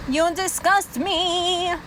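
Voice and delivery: slow, stretched-out contempt; drawn-out disgust. Real, not acted. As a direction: draw every word out in disgust. Repeats it slowly, drawn out.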